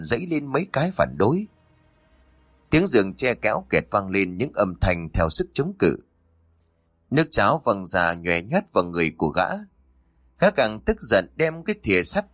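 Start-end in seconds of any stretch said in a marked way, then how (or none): none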